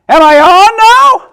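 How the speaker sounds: put-on high, loud, eager girl; loud child voice. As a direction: still booming when told to hush. Loud girl testing volume.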